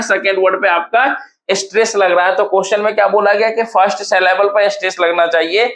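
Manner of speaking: usual speaking voice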